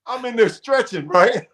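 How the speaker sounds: high, laughing through the words. Real, not acted. Telling the interviewer he just stretched, laughing. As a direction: giggle as you say it. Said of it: "laughing while telling".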